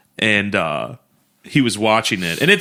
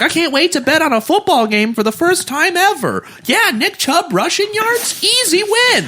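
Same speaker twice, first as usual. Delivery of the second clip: much higher, goofy voice of a clueless newbie; naive first-time bettor. Retold or imitated. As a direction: play the eager rookie with the propeller hat. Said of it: "I'm like".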